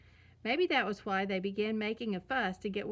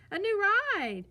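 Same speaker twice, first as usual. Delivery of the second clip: much higher, louder, thrilled squeal of a little cub; excited shout; 10 semitones higher, 7 dB louder than usual. A narrator's reading. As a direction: shout it like a kid spotting a new ride. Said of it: "shouted Sister".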